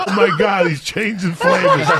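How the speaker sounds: teacher yelling out. Retold or imitated. Teacher on the bus yelling about the smell, mock yell. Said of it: "One of the teachers yelled out".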